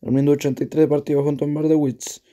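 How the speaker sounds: normal talking voice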